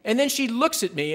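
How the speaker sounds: ordinary delivery, nothing marked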